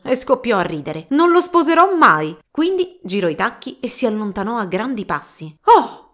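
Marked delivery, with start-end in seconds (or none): 1.1-2.4 high, loud and defiant; scornful girl who won't marry; defiant refusal. Acted. Girl defies her father. 5.6-6.0 high, loud startled gasp of a word; sudden start out of a dream. Acted. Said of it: "sobbalzò Don Chisciotte".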